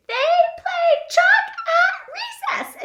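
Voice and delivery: high, slow, silly squeak with a laugh; squeaky voice. Shown as a demonstration. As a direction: squeak it like a little mouse. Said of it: "we're gonna squeak it this time".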